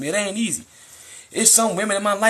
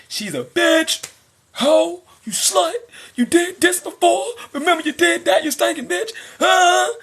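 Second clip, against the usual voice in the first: high, loud yelled insults; mock angry insults; 9 semitones higher, 4 dB louder than usual. Angry man yelling insults at baby mother. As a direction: yell like a man losing it.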